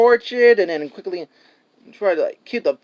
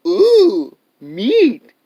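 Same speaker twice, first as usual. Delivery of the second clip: greedy crocodile; 5 semitones higher, 5 dB louder than usual. Retold or imitated. Crocodile voiced craving meat.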